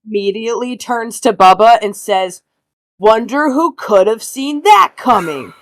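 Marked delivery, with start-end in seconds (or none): 3.0-5.5 slow, emphatic sarcastic gloating; smug jab. Acted. Boy making friend feel bad, smug and pointed. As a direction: rub it in as the boy detective. Blaine needles Bubba.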